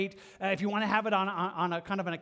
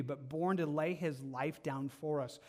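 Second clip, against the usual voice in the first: normal talking voice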